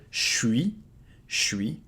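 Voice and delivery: "suis" with je merged in, said as shwee; contracted to shwee. Shown as a demonstration. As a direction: blend "je suis" into one shwee. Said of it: "contract it even more".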